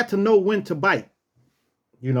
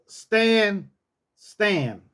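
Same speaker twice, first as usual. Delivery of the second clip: usual speaking voice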